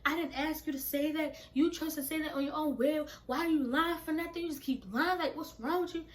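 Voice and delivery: high, rapid-fire scolding; heated retold rant, piling up accusations; going off. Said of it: "Straight going off".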